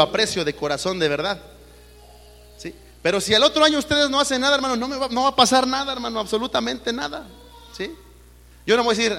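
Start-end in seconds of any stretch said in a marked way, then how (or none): 3.0-9.2 voz alta y enérgica de predicador; predicando con fuerza. Acted. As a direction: clama desde el púlpito. Preaching at full force. (predicador que advierte con fuerza)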